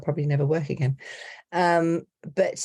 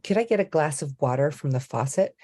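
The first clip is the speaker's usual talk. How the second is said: put-on American pronunciation; American accent. Shown as a demonstration. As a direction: order like an American, to be understood. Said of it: "being in L A".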